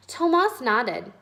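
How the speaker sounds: usual speaking voice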